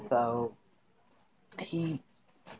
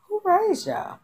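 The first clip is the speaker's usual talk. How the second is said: shocked disbelief; 4 dB louder, slower than usual. Real, not acted. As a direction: pitch leaps way up in outrage. Disbelief at the characters' behavior.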